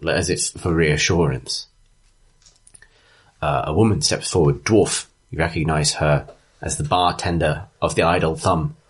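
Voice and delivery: usual speaking voice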